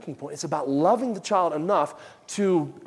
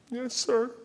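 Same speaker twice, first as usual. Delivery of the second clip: high, soft mock-child answer; imitated meek child; 7 semitones higher, 5 dB quieter than usual. Disciplined child answering Daddy, meek high voice. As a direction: answer small and high, obediently.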